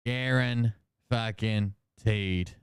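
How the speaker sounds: slow, punched guaran fucking teed; slow emphatic guarantee. Real, not acted. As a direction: hit each part of Guaran fucking teed. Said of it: "emphatic split word".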